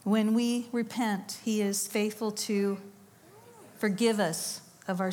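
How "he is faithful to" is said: usual speaking voice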